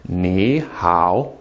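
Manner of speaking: "Ni" rising into a low "hao"; second-tone Ni. Shown as a demonstration. Teacher repeating the tone change, rising "Ni" before low "hao". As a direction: raise "Ni", then dip on "hao". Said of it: "making the first one a second tone".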